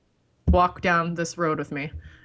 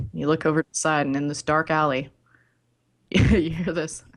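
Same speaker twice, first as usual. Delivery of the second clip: usual speaking voice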